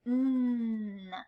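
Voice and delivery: "N" held as a long hum; drawn-out phonics "N" sound; beginning sound. Shown as a demonstration. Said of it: "What's the beginning sound?".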